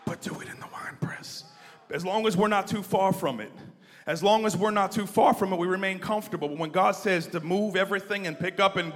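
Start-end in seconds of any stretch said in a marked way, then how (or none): 0.0-1.4 whispered again, voiceless and hushed. Retold or imitated. Repeated whispered aside. 1.9-6.5 loud, higher preaching shout. Real, not acted.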